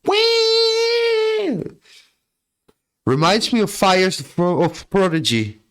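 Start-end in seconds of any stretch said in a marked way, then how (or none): 0.0-1.6 high mock whee of a ride. Heading to Mexico with chat, high gleeful whee. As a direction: squeal like on a rollercoaster. Riding into the song.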